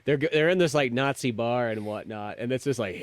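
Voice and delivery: normal talking voice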